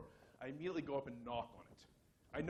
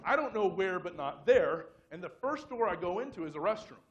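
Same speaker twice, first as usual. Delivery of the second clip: ordinary talk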